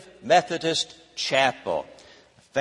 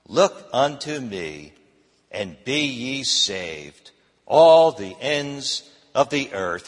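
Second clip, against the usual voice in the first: slow solemn reading; 3 semitones lower, slower than usual. Acted. Preacher reading the text slowly.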